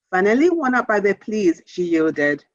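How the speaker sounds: plain reading voice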